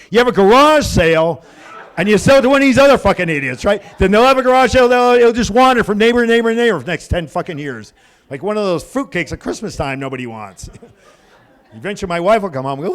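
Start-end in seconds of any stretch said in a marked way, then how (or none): 0.1-7.9 loud, exasperated rant; raised-voice comic frustration; worked up. Retold or imitated. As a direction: rant at full voice, building in pitch. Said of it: "I gotta calm down before I bust a blood vessel".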